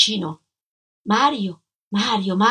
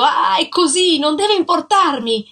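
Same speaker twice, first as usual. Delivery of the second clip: loud, higher angry shout while slapping her; 7 semitones higher, 5 dB louder than usual. A narrator's reading. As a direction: let the jealousy explode. (gridato, furioso)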